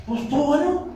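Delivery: high, thin mock reply again; bored husband. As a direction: shrug it off, high and lazy. Husband answers wife after service.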